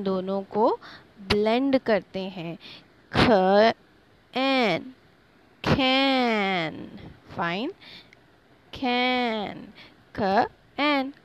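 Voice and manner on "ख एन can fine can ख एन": sounds stretched apart, letter by letter; slow teacher sounding-out; dragged out. Shown as a demonstration. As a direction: drag each sound so children hear it clearly. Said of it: "थोड़ा सा drag करके पढ़ा".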